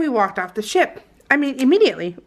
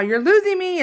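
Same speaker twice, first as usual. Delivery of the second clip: higher, mock husband; tossing off a line as the husband; lightly voiced husband; husband's quip; 9 semitones higher than usual. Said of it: "he's all".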